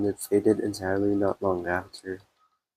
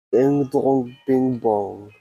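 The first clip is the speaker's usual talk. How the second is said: mock British ding dong chime, a bit louder; British accent; 4 semitones higher, 5 dB louder, much slower than usual. Streamer copies the game's chime in a British accent. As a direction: announce it like a posh British chime. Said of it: "saying it in British accent".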